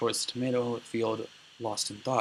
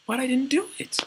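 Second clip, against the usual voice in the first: very high, loud squeal of innocence; acted pleading denial; 5 dB louder than usual. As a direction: insist in a squeaky, hammed-up plea. Accused character insists on innocence.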